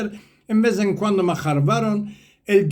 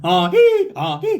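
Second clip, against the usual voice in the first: imitated donkey bray; 9 semitones higher, 4 dB louder than usual. Storyteller showing how the donkey brays, imitated hee-haw. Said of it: "anurearse, dice en turco".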